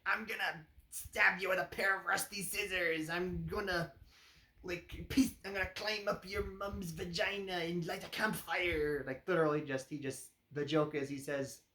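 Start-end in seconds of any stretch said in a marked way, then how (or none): 0.0-9.0 leprechaun threat. Retold or imitated. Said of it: "he's like".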